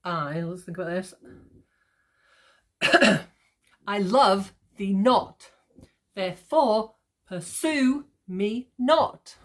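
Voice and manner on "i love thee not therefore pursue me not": slow, punching "love", "not", "fore", "sue", "not"; "i LOVE thee NOT, there-FORE pur-SUE me NOT"; iambic stress. Shown as a demonstration. Teacher demonstrating Shakespeare language, slow emphasis on every second syllable. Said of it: "Emphasis on every second syllable".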